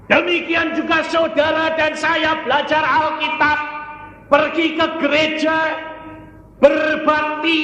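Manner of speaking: high, sustained shouting; loud, high sermon cry; full-force preaching. Real, not acted. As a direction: hammer each phrase at the congregation.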